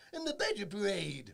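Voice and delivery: loud, high, drawn-out call; mock show announcement. Recalling a comedian's TV show, mock announcement. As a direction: belt it out slowly like a show title. Imitating a show's catchphrase.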